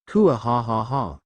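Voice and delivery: slow, hammed-up evil cackle; a drawn-out gloating mock-villain laugh; dragon's smug triumphant laugh; villainous laugh.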